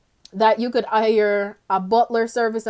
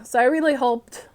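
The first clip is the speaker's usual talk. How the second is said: normal talking voice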